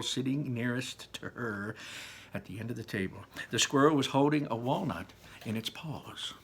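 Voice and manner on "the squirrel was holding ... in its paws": plain reading voice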